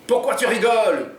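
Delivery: high, loud demand from Jean; Jean yelling at the hostage who laughs; mock yell. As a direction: snap at the laughing woman. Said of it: "hurle Jean".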